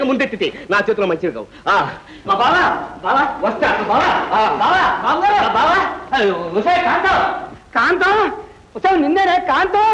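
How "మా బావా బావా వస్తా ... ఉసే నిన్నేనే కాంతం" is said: hollering for his wife across the house; shouting. Acted. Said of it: "ఎందుకు అలా గొంతు చించి అరుస్తారు". (భార్యను గొంతు చించి పిలవడం)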